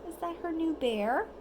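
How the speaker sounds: plain reading voice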